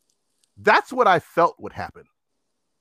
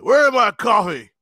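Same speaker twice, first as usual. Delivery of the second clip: put-on drunk customer; 5 semitones higher, 5 dB louder than usual. Acts drunk customer demanding coffee.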